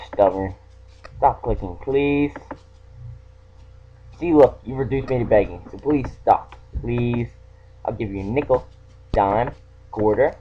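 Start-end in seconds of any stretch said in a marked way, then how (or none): none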